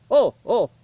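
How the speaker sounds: put-on jolly Santa ho-ho in a Swedish accent. Santa greets the reviewer, jolly Swedish accent. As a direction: Santa booming with a Swedish lilt. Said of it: "what's with the Swedish accent?".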